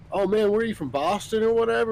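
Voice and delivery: retold curious question. Asking the engineer if he's from Boston, high retold question. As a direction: ask it high, as a retold exchange.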